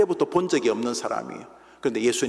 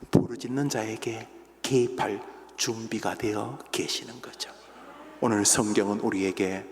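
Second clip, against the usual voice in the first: hushed preaching; 2 semitones lower, 5 dB quieter than usual. Acted. Preacher quietly urges the congregation, hushed preaching. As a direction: lean in and hush the room.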